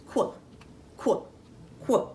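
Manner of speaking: "quack" as a short blended "kwuh", spaced out; blended duck sound. Shown as a demonstration. Teacher blending two sounds, "quack" as separate short "kwuh"s. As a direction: say "quack" as k and w run together, pausing between each. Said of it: "put together really fast".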